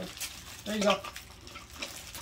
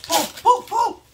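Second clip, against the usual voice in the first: high, loud pull pull pull; excited cheering; 9 dB louder, slower than usual. Real, not acted. Cheering baby to pull paper.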